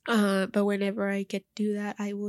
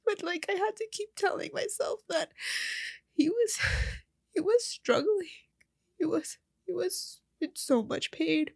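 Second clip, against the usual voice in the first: tearful, voice breaking into a whisper; crying; 7 semitones higher than usual. Real, not acted. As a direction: choke the words out through tears. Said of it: "how much I'm crying".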